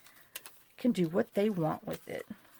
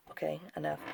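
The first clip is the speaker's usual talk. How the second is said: whisper; 3 semitones lower, 9 dB quieter, faster than usual. Real, not acted. Scolding herself in aside.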